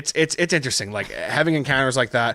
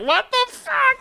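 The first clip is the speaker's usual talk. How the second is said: very high, drawn-out freak-out squeal; hammed-up scared exclamation; mock horror; slower than usual. Acts out horror at the show.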